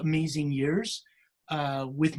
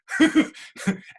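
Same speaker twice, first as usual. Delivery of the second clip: light laugh; 4 semitones higher, 6 dB louder than usual. Real, not acted. Laughs at own story.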